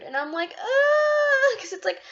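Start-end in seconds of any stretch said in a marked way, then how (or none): 0.6-1.6 high, loud, whiny groan of frustration; frustrated groan. Retold or imitated. Groaning at herself for interrupting friends, high whiny ugh. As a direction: let out a high, crying ugh.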